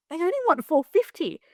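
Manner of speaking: high-pitched retold excitement; excited bargain news. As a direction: imitate him breaking the news, pitched well up. Partner reveals bargain, retold.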